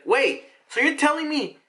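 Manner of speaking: put-on high, eager little-girl question; hopeful child. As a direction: ask it brimming with hope. Girl asks with hope.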